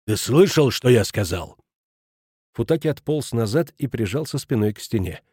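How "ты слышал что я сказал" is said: tense, menacing question; hissed threat. A narrator's reading. Schmidt standing over Futaki, hissed threat. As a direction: press the question home menacingly. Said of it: "прошипел Шмидт".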